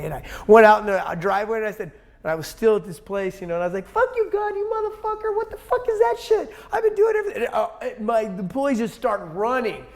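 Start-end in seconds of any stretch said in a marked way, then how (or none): 3.9-7.4 much higher, screaming rage at God; retold furious outburst. Man snapping in his shop driveway, yelling at God in fury. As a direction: let it rip like you've finally snapped. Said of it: "and I just snapped one day".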